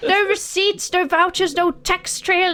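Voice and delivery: high, indignant shopkeeper rant; high-pitched merchant fed up; high exasperated merchant. Acted. Merchant rants.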